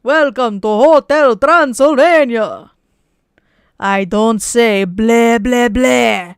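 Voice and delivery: put-on loud, slow Transylvanian Dracula accent. Streamer showing off a Russian-ish voice for chat, loud slow Dracula accent. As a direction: boom it out like Count Dracula in a thick Transylvanian accent. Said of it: "sounds like I'm fucking Dracula from Transylvania".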